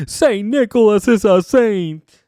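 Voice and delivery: mock heartfelt tribute; hammed-up proclamation. Cena praising Santa, overacted high and loud. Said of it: "Cena is like".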